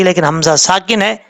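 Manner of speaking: usual speaking voice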